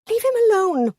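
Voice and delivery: high, loud panicked cry. A narrator's reading. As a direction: cry out in panic to protect him. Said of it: "her voice sounded panicked".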